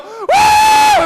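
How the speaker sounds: high, loud comic whoop; wild whoop. Retold or imitated. Crazed jumper answers the jump master, wild whoop. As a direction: let out a crazed high yell.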